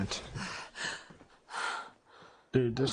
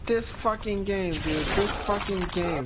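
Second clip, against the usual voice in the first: furious rant; 3 semitones higher than usual. Real, not acted. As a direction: spit it out in rage. Raging at game.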